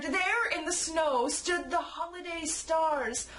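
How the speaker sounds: ordinary delivery, nothing marked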